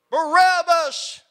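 long, loud, drawn-out yell of a name; crowd yelling. Acted. Preacher voicing the mob outside the jail, a loud yell. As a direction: bellow it like an angry mob. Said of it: "And the crowd said".

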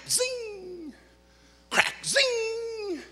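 high whistling zing of bullets. Retold or imitated. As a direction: mimic ricochets whizzing by. Imitating bullets whizzing.

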